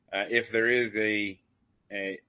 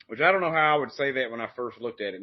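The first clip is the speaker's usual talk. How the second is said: usual speaking voice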